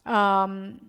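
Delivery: ordinary talk, nothing marked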